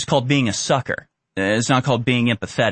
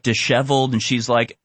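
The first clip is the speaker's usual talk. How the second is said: ordinary delivery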